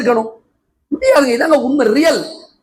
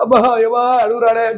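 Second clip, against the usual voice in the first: mock lament. Mocking teary audience.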